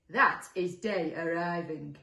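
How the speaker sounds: slow, loud, insistent monster; drawn-out character correction; annoyed second monster. Acted. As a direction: insist loudly, word by word. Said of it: "answered the second".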